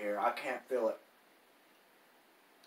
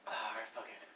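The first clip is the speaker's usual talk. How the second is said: whisper; 5 semitones lower, 13 dB quieter than usual. Real, not acted. Giving up under the heat.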